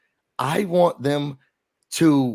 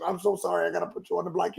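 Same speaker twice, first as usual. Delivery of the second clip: mock sorry, softer; 2 semitones higher, 8 dB quieter, faster than usual. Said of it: "She was like".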